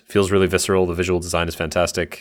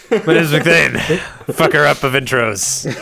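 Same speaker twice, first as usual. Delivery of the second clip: booming mock-heroic self-introduction, higher and louder; mock grand; 8 semitones higher, 4 dB louder than usual. Host re-announcing himself after botching the intro, mock grand. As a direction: proclaim it like a fallen champion.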